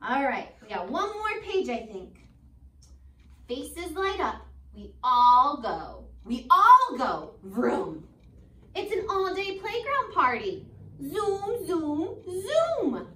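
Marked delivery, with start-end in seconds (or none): 3.5-13.1 slow, lilting storybook read; gentle read-aloud for kids; reading to children. Acted. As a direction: read it like a bedtime picture book. Teacher reads picture book aloud.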